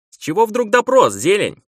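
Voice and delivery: high-pitched call to someone behind; called over the shoulder. A narrator's reading. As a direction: call it out sharply, pitched well up. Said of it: "крикнула она через плечо". (крикнуть через плечо, высоким голосом)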